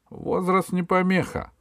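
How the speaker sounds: высокий неуступчивый голос; отмети довод высоким голосом; епископ-обвинитель. A narrator's reading. Епископ возражает заступнице. (высоко и непреклонно)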